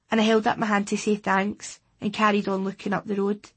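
ordinary delivery, nothing marked

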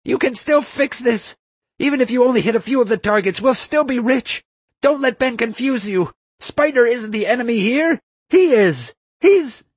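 very high, loud, desperate shrieking; high-pitched furious screams, cut off at the end; screaming. A narrator's reading. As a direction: scream frantically at your partner. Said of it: "screaming at Nefarious the entire time".

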